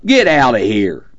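drawn-out cop's yell; yelling back. A narrator's reading. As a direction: holler it at the truck driver. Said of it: "The cop turned around and yelled back".

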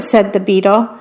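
ordinary delivery, nothing marked

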